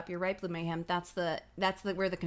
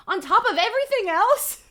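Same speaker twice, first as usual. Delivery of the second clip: much higher and louder incredulous cry; hammed-up disbelief; 12 semitones higher, 10 dB louder than usual. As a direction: squeal it in mock panic. Reader hams up character's panic.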